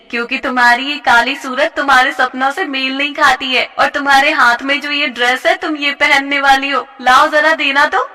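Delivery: taunting bully. Acted. A bully sneering at a dancing classmate. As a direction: crush her hopes with scorn. Jyoti demanding dress.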